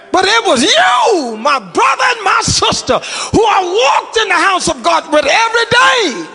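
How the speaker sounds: high, loud whooping preacher's shout; fiery pulpit climax, high and loud; full-force preaching. Acted. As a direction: preach it to the back row.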